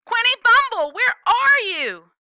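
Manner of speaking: high, loud and slow worried call; yelling. Acted. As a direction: drag the call out, searching for a lost child. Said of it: "says the yelling".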